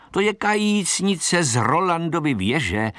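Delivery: high-pitched mock children's cry; lightly shouted taunt; children shouting. A narrator's reading. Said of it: "křičeli a nezřízeně se smáli".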